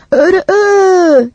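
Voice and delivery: high, loud, slow rooster crow. A narrator's reading. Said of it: "diye bağırdı". (yüksek sesle, yavaş horoz ötüşü)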